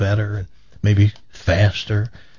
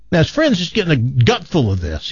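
normal talking voice

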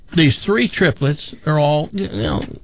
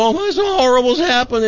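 mock hysterical; 10 semitones higher, faster than usual. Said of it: "she's crying and hysterical".

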